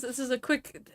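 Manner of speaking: normal talking voice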